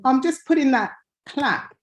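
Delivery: ordinary talk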